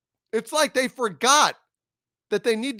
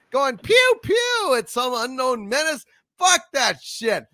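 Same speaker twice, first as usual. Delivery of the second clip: loud, higher angry ranting; furious rant; 5 semitones higher, 3 dB louder than usual. Real, not acted. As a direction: shout it down with disgust. Furious rant about trailer.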